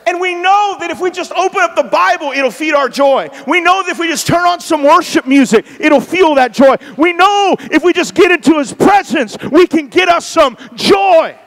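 loud, high building preach. Real, not acted. Pastor rallying the congregation, loud rising preaching. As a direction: build it like a sermon climax.

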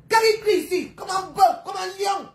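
high-pitched indignant outburst; real exasperation, voice pitched well up; indignant complaint. Real, not acted. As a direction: call it out high, each phrase falling. Complaining aloud about the shouting neighbour.

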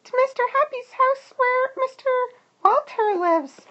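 very high, squeaky little worm; Cheryl answering. A narrator's reading. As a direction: pipe up in a tiny worm voice.

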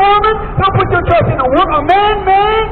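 ordinary talk

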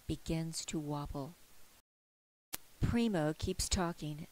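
ordinary delivery

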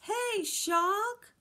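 high, big hailing call; high-pitched storybook whale; booming whale. A narrator's reading. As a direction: hail the shark in a high whale voice. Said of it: "boomed the whale".

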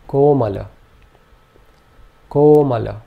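komala with a short middle vowel; vowel contrast. Shown as a demonstration. Teacher showing another vowel length in komala. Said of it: "this would be pronounced".

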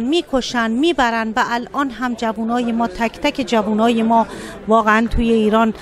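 ordinary talk, nothing marked

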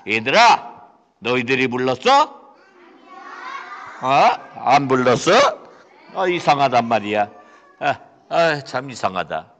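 deep, gruff rich man puzzling; a big rich man asking his children. Acted. Rich man asking his children who called.

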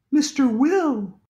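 high, drawn-out schoolgirl voice; pointed girl. A narrator's reading. Andrea names who lost out, high and slow. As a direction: draw the name out high, like a girl.

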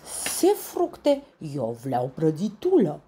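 loud, high outraged lisp; roaring lion. A narrator's reading. King Coffee roaring down at Tafiti, lisping outrage. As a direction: roar it, scornful of fruit. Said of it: "rage King Coffee".

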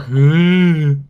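loud, long gasped "hoo"; startled gasp. Real, not acted. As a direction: suck in a loud, startled breath. Hears more noises in game.

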